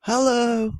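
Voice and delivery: loud high yelled hello; accidental yell into the microphone. Real, not acted. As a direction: yell it into the mic. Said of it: "I yelled it".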